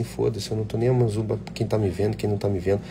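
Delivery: ordinary talk, nothing marked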